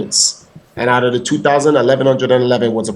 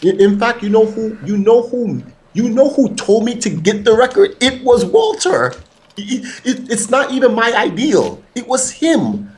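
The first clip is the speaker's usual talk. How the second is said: excited reveal; 9 semitones higher than usual. Real, not acted. Excited reveal that Walter suggested record.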